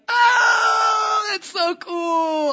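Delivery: very high, loud, drawn-out shriek; imitated thrilled squeal. Friend hearing of rainbows, thrilled squeal. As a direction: squeal with hammed-up delight.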